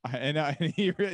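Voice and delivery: ordinary talk, nothing marked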